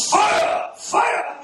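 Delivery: high loud cry of Fire, hammed up; imitating a Pentecostal preacher's shout. Mimicking the preacher calling fire over the line, loud shout. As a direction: belt it out like a fiery preacher. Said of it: "he's very Pentecostal".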